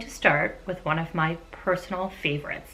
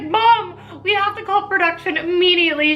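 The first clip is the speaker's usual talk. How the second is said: high, loud mock panic; imitating panicked daughter; 11 semitones higher, 3 dB louder than usual. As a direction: squeal it like a scared teen begging. Voicing panicked daughter Chloe.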